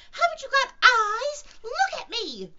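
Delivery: very high, very loud squealed demand; shout. Acted. Piglet demanding Kanga look at him, squeaky shout. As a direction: yell it slowly in a piglet's squeak. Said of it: "shouted Piglet".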